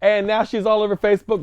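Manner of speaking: ordinary delivery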